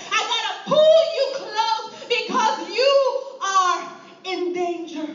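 loud, half-laughing, half-crying plea; urgent shepherd. Acted. Shepherd to a straying sheep, urgent loud plea. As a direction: pull them in before it's too late.

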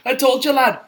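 loud, high mock Scouse shout; Paddy the Baddy impression. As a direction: bellow it like a hyped fighter. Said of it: "seen him on TikTok going".

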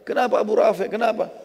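high-pitched put-on voice; changed voice. Abdullah posing as a helper, asking urgently in a changed voice. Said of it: "Saya ubah lagi suara saya".

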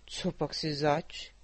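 slow, low, puzzled dragon; surprised. A narrator's reading. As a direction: drag it out in wonder. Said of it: "zeptal se překvapeně Ludvík". (překvapený drak, pomalu a hluboce)

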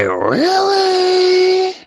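high, stretched, crying "Really?"; squealed, drawn-out "Really?"; mock disbelief. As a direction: wail it in put-on disbelief. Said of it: "Then I was like".

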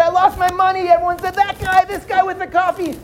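high, urgent voices; excited accusation. Acted. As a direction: point him out eagerly. Victim and crowd point.

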